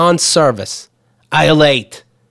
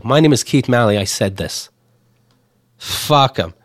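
ordinary talk, nothing marked

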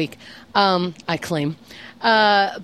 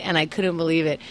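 ordinary delivery

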